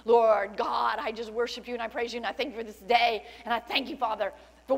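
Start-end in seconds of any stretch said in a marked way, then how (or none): none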